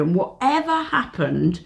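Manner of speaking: usual speaking voice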